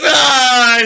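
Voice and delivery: high, loud, drawn-out "ah"; imitated dismissive cop cry. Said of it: "He goes".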